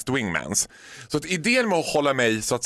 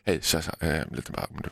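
imitating a timid, much lower, softer mumble that fades to a whisper; cautious and quiet; 11 semitones lower, 6 dB quieter, faster than usual. Host imitating colleague creeping up to the counter, shy hushed mumble. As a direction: sneak up shyly and barely ask. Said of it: "försiktigt tyst och bara".

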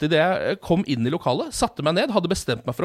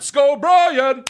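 high, loud, drawn-out cheer; British fan shout; 9 semitones higher, 7 dB louder, much slower than usual. Retold or imitated. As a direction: holler it slowly across the arena, British style. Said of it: "De roper på britisk".

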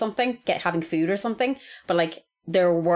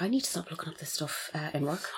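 quietly to herself; 3 semitones lower, 12 dB quieter than usual. Retold or imitated. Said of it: "I was like".

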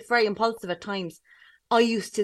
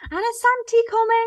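put-on high sing-song voice for a small child; mock child-directed question; talking to a child; 11 semitones higher, 4 dB louder than usual. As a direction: pitch up as if to a little niece. Talking to niece on phone.

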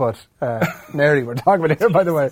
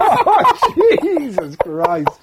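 slow laughing groan; laughing in mock outrage; 10 semitones higher, much slower than usual. Real, not acted. Laughing at the listener's story about the nurse.

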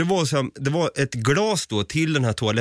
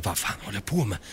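quiet self-talk; 4 semitones lower, 7 dB quieter, faster than usual. Retold or imitated. Muttering to himself outside.

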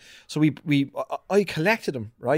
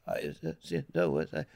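mock feeble mumbling; 10 dB quieter than usual. Mimics what his dad wasn't.

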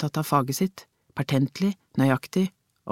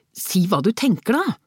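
higher-pitched irritated outburst; 6 semitones higher, 4 dB louder than usual. A narrator's reading. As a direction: burst out in annoyance. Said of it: "utbrøt han irritert". (irritert utbrudd)

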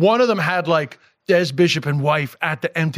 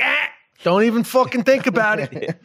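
higher-pitched, playful telling-off; mock stern warning; 5 semitones higher than usual. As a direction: warn them off in pretend outrage. Retells scolding his wife's accent.

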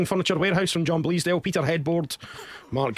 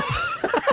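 high giggle. Real, not acted. Laughing at silly pun names.

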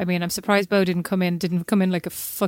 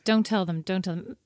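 ordinary talk

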